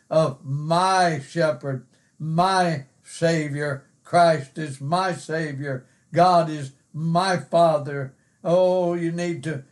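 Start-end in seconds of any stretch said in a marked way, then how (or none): 0.4-8.1 slow phrases, each "my" drawn out and weighted; emphasis on my. Shown as a demonstration. Preacher urging emphasis on the personal pronoun, stressing each "my". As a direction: lean on every "my", taking it slowly. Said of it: "put some emphasis on that personal pronoun".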